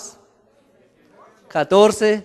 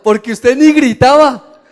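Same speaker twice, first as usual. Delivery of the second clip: higher, louder laughing quote; laughing retelling; 6 semitones higher, 8 dB louder than usual. Retold or imitated. Retelling the girl's remark after the ride, laughing. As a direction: laugh while retelling her words. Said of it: "la muchacha que estaba al lado me dijo".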